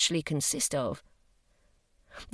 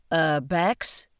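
slow, flat, even robot voice; robotic level voice. Acted. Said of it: "suggested his robot".

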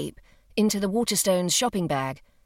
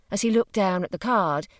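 plain reading voice

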